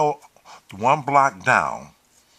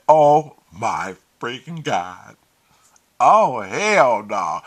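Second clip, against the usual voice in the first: slow, louder drawn-out dismay; appalled disbelief; 6 dB louder, much slower than usual. Retold or imitated. Finding her posing with the drug boys, slow appalled outburst. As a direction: drag out each word in loud disbelief.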